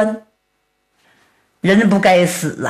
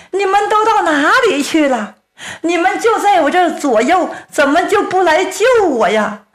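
音调拔高，埋怨地哀叹; 绝望地念叨兄弟们; 临终哀叹; 6 semitones higher, faster than usual. A narrator's reading. 临死前哀叹兄弟不来救. (哀怨呼唤)

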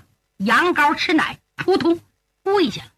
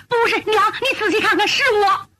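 earnest pleading; 8 semitones higher, faster than usual. Acted. Son convincing disbelieving mother.

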